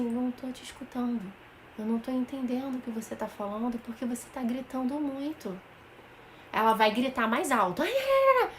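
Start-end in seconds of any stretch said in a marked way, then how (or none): 0.0-5.6 calm quiet voice. Shown as a demonstration. Mother to her screaming child, calm and soft. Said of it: "Tem que falar baixo pra mamãe entender". 7.8-8.5 mock squeal. Child yells louder, mock squeal.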